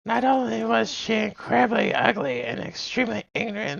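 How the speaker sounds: witch voice. Acted. Streamer to chat, telling a story in a high witch voice. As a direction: croak it out like a fairy-tale witch. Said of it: "That's more like a witch voice".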